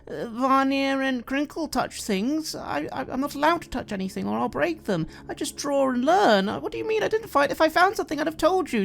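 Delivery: put-on high, fast, defensive little girl; anxious young girl pleading her case. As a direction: play the upset child who insists she didn't hide anything.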